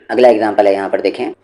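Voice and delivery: usual speaking voice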